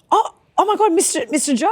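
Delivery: high-pitched flustered gasp of recognition; flustered realization. Retold or imitated. Fan caught not recognizing the rapper, flustered. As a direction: re-enact mortified surprise, voice shooting up.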